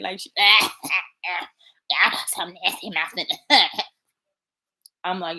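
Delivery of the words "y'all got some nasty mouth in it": slow, strangled choking words; mock choking. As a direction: hack and splutter through the words. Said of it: "that's how she be doing it".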